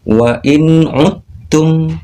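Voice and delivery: very slow wa in uttum, tt merged; slow recitation demo. Shown as a demonstration. Teacher repeating the example slowly. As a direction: stretch out wa in uttum. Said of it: "Saya ulangi".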